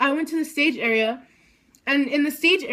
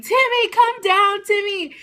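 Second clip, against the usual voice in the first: high, loud call across the room; yelling to him; 8 semitones higher, 5 dB louder than usual. Retold or imitated. Fan yelling to her idol on the ramp, high and loud. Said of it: "decided to yell at him".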